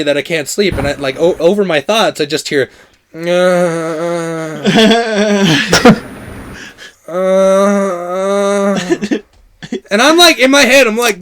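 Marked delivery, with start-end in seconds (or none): 3.1-5.9 long, drawn-out groan; imitated sleep moan. Imitating his friend moaning on the bedroom floor, long mock moan. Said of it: "these are way too long moans". 7.1-8.8 long, drawn-out groan; imitated sleep moan. Imitating his friend moaning on the bedroom floor, long mock moan. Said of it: "these are way too long moans".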